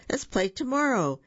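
high, mildly shouted glee; excited shout. A narrator's reading. Boo shouts to his new friends, excited. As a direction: call out eagerly, a lightly acted shout. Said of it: "Boo shouted".

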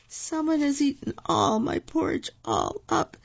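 high, wee voice full of woe; a tiny cub's sad complaint; high little bear, sorrowfully acted; sad baby bear. A narrator's reading. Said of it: "said the baby bear sadly".

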